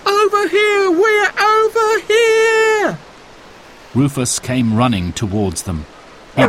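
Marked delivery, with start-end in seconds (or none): 0.1-0.9 high loud call, quick; children calling to rescuers; shouted. A narrator's reading. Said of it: "they shouted". 0.9-3.0 high loud call, slow and stretched; children calling, drawn out; drawn-out shout. A narrator's reading. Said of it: "they shouted".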